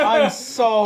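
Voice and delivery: embarrassed giggling apology; laughing. Real, not acted. Apologizing to Kevin while laughing.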